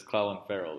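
Clelin said KLEH-lin, Ferrell said FAIR-ul; short E in both Clelin and Ferrell; mistaken pronunciation. Shown as a demonstration. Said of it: "I have been thinking of it as".

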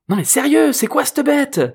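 put-on high, loud girl's protest; indignant teen girl. A narrator's reading. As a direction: complain in disbelief about the animal. Girl recoils from the growling dog.

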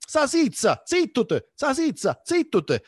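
high, loud, sharply falling calls; mock scolding shout. Owner telling dog Sazitza to shut up, mock shout.

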